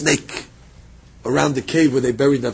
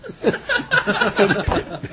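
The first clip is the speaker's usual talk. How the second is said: high chuckling laugh; real amused giggle; 5 dB quieter than usual. Real, not acted. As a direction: laugh in a quick high run. Laughs after telling of apology.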